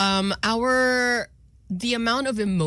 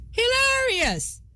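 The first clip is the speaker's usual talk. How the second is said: hilarious, higher; 13 semitones higher, slower than usual. Shown as a demonstration.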